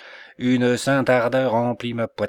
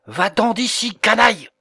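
angry shout; 10 semitones higher, 6 dB louder than usual. Acted. Saint Peter drives out intruder.

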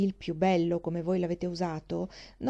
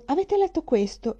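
higher, louder mock gossip on letto; mocking quote; 8 semitones higher, 4 dB louder than usual. Henry mocking endless book talk, higher and louder. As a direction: parody of a tedious book chat. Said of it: "quell'interminabile conversazione che comincia".

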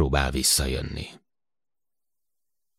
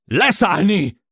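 high, full-force shouted command; bellowed order; 7 dB louder, slower than usual. A narrator's reading. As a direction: yell the order to land. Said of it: "ordította Jill". (ordítva kiadott parancs)

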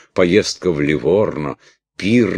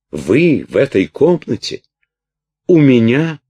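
sudden high shocked cry; 10 semitones higher, 9 dB louder than usual. A narrator's reading. Princess cries out at her jailer, shocked and loud. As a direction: cry out in horror at the intruder. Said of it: "вскрикнула княжна".

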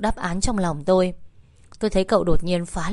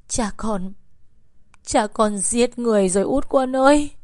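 halting, then a higher sobbing wail; tearful blurting; 5 semitones higher than usual. A narrator's reading. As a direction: blurt out the terrible news as a weeping mother. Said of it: "Bà ấy mếu máo nói". (mếu máo kêu than)

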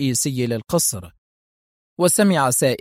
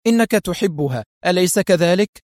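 higher, sharp accusation; pointed, raised-pitch challenge; 6 semitones higher than usual. A narrator's reading. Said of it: "فقالت في حدة".

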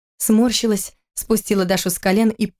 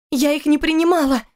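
higher, desperate protest; desperate defense; 8 semitones higher than usual. Acted. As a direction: she tries to justify herself. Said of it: "попыталась она оправдаться". (оправдываясь, отчаянно, выше)

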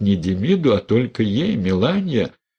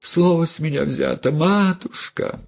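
высоко, медленно, с причитанием; тяни жалобно, как деревенская баба; причитающая баба; 8 semitones higher than usual. A narrator's reading. Мелания голосит перед игуменьей. (Мелания причитает перед игуменьей)

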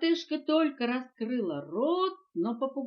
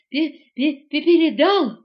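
loud, panting stutter; breathless stammer; 5 semitones higher, 15 dB louder than usual. A narrator's reading. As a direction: stammer it out in bursts, winded from running. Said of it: "выдохнул слонёнок". (запыхавшись, заикаясь)

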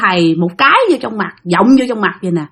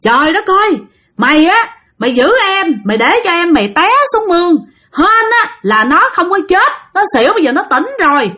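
higher-pitched retold tongue-lashing; angry scolding; 5 semitones higher than usual. Great-grandparents berate the child for letting the sibling fall, scolding. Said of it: "ông bà Cố chửi tiếp".

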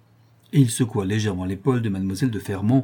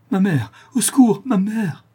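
high, loud, terrified cry for her mother; cry of terror; 11 semitones higher, 5 dB louder than usual. A narrator's reading. Sick girl wakes to strangers, cries for mother in terror. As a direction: scream for help in sheer panic. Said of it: "dans un cri d'angoisse et de terreur".

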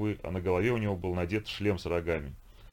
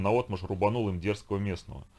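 usual speaking voice

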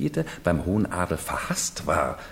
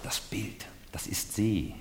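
softer, breathy hushed urgency; urgent whisper; 2 semitones higher, 8 dB quieter than usual. Acted. Servant points out the portrait to the newcomer, in an urgent whisper. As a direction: whisper so no one overhears.

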